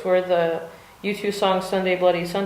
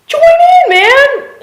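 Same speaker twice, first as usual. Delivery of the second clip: much higher, loud yell; excited shout; 13 dB louder, much slower than usual. Real, not acted. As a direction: belt it out like a cheer. Calling out to viewers.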